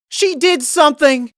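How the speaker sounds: accusing shout. A narrator's reading. Big Mac accuses on seeing sister.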